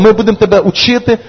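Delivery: ordinary delivery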